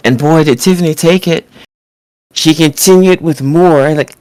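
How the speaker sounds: usual speaking voice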